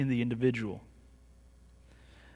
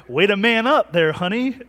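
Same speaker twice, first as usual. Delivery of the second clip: mock teasing wife; 5 semitones higher, 8 dB louder than usual. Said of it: "It's like".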